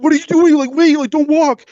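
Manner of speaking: high, sharp mock shout; jacked guy yelling at the girl to stop, high and loud; retold yell. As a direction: call out high like a panicked meathead. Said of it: "yelled out to her".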